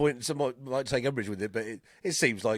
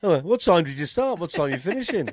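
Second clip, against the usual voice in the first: cabbie small talk; 6 semitones higher, 6 dB louder than usual. Retold or imitated. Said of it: "the same thing still comes".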